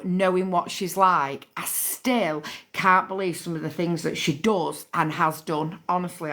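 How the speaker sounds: ordinary talk, nothing marked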